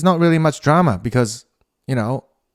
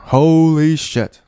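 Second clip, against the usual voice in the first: shocked; 4 semitones higher, 5 dB louder, much slower than usual. Real, not acted. Shocked at the news.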